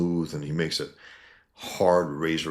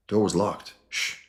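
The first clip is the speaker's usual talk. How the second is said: ordinary talk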